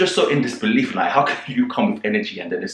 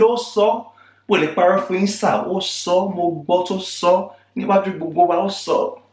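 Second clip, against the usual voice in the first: higher emphatic Yoruba testimony; higher insistent Yoruba; insisting in Yoruba; 7 semitones higher than usual. Retold or imitated. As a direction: swear to it in Yoruba, pitched up. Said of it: "I even said it in Yoruba".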